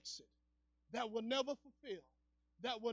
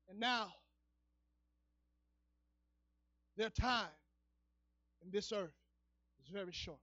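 solemn hushed preaching; 2 semitones lower than usual. Real, not acted.

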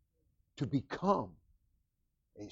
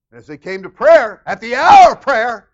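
preaching at full force; 8 semitones higher, 21 dB louder than usual. Acted. Preacher proclaims the apostles' hour of prayer. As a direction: ring it out to the back pews.

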